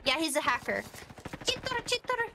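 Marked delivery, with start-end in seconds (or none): 1.5-2.3 high-pitched pretend tantrum; mock raging squeaker. Yelling at a cheater in fun, high voice. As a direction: squeal insults far above your voice.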